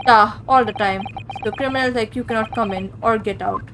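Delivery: stern guard; firm Russian accent. Acted. Stroganoff warns the stowaway in a Russian accent.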